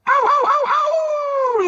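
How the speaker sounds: high, slow, loud dog yelps; a puppy's drawn-out yelping cry. Acted. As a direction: yelp it out like a hurt doggy. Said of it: "So I yelped ... like a doggy".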